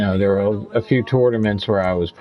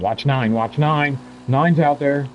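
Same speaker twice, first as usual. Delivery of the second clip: mild mock yell, pitched up; retold yelling; 8 semitones higher, slower than usual. Opponents warning each other about number nine, mock yelling. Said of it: "they're yelling".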